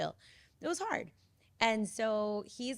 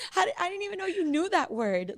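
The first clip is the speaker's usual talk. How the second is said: higher and louder, amazed; amazed disbelief; 9 semitones higher, 6 dB louder than usual. Retold or imitated. Mother amazed her child knew that word, disbelieving. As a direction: react in astonished disbelief.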